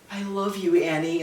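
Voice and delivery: soft farewell said at her last breath; faint dying words. Acted. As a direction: barely voice it like a dying mother.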